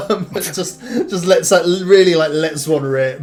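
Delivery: loud tipsy joke-telling; drunk joker. Acted. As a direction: cackle through a crude gag. Drunk tells fart joke.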